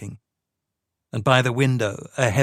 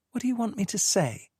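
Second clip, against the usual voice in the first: sweetly; 10 semitones higher, 5 dB quieter, faster than usual. A narrator's reading. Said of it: "she asked sweetly".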